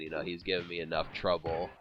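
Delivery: normal talking voice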